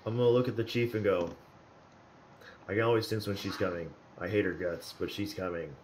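ordinary delivery

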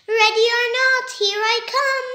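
high, loud, fast singsong call; shouting. Acted. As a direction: call out like starting hide and seek. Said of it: "He shouts".

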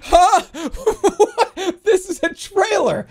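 high, loud, laughing huh; real laughing disbelief at the game. Real, not acted. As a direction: laugh through the shock.

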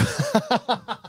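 short high burst of laughter; high giggle. Real, not acted. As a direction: crack up at the joke. Laughs at grim superchat.

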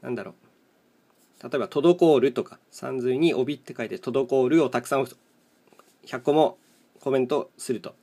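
ordinary delivery

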